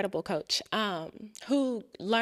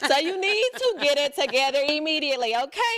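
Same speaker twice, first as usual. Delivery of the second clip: higher, louder, sassy scolding; tough-love coach; 6 semitones higher, 8 dB louder than usual. Retold or imitated. Guest imitating her coach demanding she get it together, loud.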